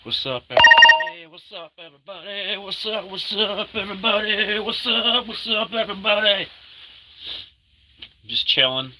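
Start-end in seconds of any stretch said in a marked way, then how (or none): 0.0-1.3 big loud hello; loud greeting. Acted. Greeting viewers, loud call out. 1.3-6.5 high-pitched playful greeting; squeaky goofy hello; high silly voice. Pretend.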